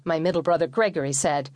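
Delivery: ordinary delivery, nothing marked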